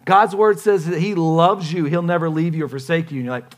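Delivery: usual speaking voice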